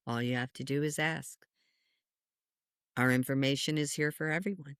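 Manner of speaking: low, measured oracle voice with long pauses; grave and hushed, like a divine messenger; Einstein. Acted. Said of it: "And, uh, he goes".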